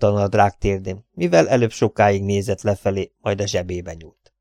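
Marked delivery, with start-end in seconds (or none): none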